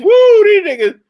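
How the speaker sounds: loud high whoop of amazement; sudden high excited cry; excited woo. Real, not acted. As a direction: whoop it out, impressed. Excited shout while watching.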